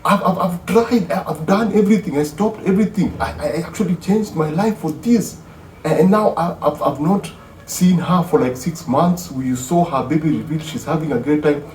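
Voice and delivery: stammering defence; high-pitched flustered husband; voicing the flustered husband. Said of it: "the guy is like".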